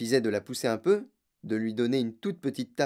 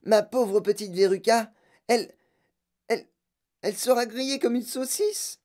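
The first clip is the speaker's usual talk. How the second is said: high, halting lament, stuck on a word; stammer, then wail up high; distraught mother; 9 semitones higher, 3 dB louder than usual. A narrator's reading. Madame Salt fears for Veruca in the furnace, distraught. Mother panics over her daughter's fate.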